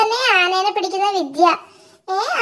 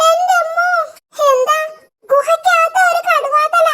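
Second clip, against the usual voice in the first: terrified cry; 8 semitones higher than usual. Acted. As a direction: panic at the tiger in the cave. Frightened friend sees tiger head. (കടുവയെ കണ്ട് പേടിച്ചു നിലവിളിക്കുന്നു)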